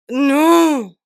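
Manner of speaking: loud, high, drawn-out no; frustrated cry. Real, not acted. Vlogger botches the take, loud frustrated cry. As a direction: wail it out at your own mistake.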